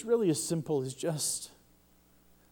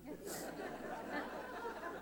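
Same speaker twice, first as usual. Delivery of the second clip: short laugh; 16 dB quieter than usual. Real, not acted. Laugh after joke.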